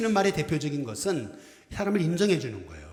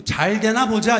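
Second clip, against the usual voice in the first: sarcastic "wait and see", pitched up and louder; mock threat; 8 semitones higher, 7 dB louder, slower than usual. Shown as a demonstration. Pastor showing the wrong greeting, as a mock taunt. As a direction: sneer it like a challenge. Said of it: "이게 아니고요".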